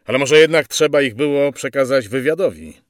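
soldier's loud objection; questioning a superior. Acted. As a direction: put a doubt forcefully to your officer. Sergeant questions the officer.

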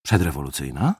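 wary echo question. Acted. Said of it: "Zmarszczył brwi milicjant".

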